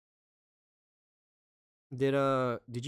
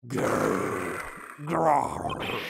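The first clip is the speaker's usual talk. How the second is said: a low mock tiger growl from the throat; 3 semitones lower, 7 dB louder than usual. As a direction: growl like a big cat.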